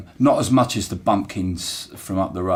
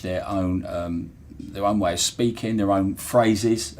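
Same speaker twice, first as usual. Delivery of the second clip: usual speaking voice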